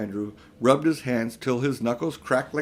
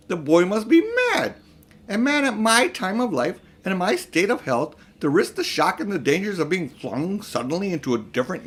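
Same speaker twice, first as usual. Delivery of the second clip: high, loud outraged disbelief; surprised and offended; 6 semitones higher, 4 dB louder than usual. A narrator's reading. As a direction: sputter in offended shock at the idea. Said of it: "surprised and offended as his uncle did".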